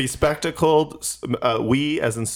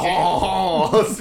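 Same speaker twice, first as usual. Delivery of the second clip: drawn-out mock-French haws; hammed-up French accent; fake French chef; 3 semitones higher than usual. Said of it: "sounds like a fake French chef".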